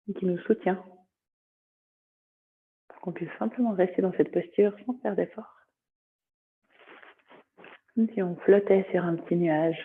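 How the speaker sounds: calm yoga nidra guidance, long pauses between phrases; guided meditation. Acted. Teacher guiding students through body sensations, meditative voice.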